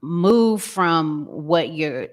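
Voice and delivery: ordinary talk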